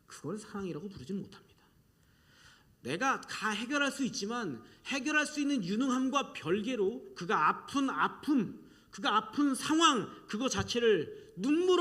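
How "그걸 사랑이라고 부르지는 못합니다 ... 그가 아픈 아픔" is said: ordinary talk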